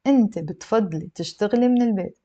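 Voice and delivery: أنت بتفضلي تشتغلي من البيت as a plain statement, البيت falling; statement tune. Shown as a demonstration. Teacher confirming the statement for students, falling tune. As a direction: bring البيت down, no question in it. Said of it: "That's a statement".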